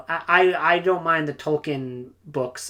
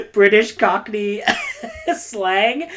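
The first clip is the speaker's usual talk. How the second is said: higher, louder, breaking into laughs; laughing; 7 semitones higher, 5 dB louder, slower than usual. Real, not acted. Book reviewer tickled by orcs talking Cockney, laughing through it. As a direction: laugh between the words. Amused by the orcs' Cockney slang.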